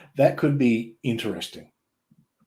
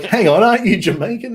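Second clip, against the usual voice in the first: high, loud mock disbelief; mock incredulous question; 10 semitones higher, 5 dB louder than usual. Retelling commentators questioning Kofi, high mock disbelief. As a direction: play the baffled commentators catching him out. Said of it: "And they're like".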